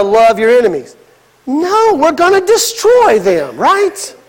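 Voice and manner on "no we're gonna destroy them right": higher, slow disbelieving protest; a disciple who can't accept it; mock-incredulous Judas pleading; indignant Judas.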